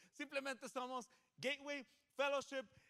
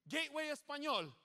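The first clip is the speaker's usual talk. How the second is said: higher, louder answer; response call; 6 semitones higher, 5 dB louder than usual. Shown as a demonstration. Pastor models the congregation's answer, calling out. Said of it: "ustedes van a decir".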